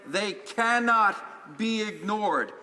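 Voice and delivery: cannot leaned on, words drawn out slowly; slow, deliberate weight on cannot; stress on cannot. Retold or imitated. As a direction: hit the word cannot hard and slow. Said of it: "Emphasis again on cannot".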